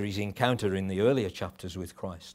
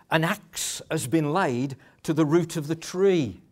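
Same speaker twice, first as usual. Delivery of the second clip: stern proclamation; 6 semitones higher, 6 dB louder than usual. Retold or imitated. Quoting John's stern warning.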